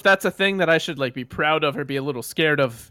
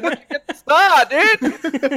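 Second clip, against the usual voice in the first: put-on drunk bro; 9 semitones higher, 5 dB louder, slower than usual. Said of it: "I was like".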